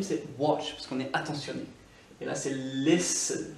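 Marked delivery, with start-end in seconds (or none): 2.5-3.5 "listen" said slowly with the T silent. Shown as a demonstration. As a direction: leave out the T in "listen". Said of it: "Le T ne se prononce pas".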